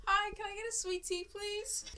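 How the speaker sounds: small, high polite ordering voice. Shown as a demonstration. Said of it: "It's just me trying to pitch my voice up".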